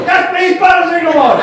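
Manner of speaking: very high, loud mimicry; mirroring the chemist. Customer copying the chemist's voice, high and loud. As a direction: shout it back in the same high voice. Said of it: "You go".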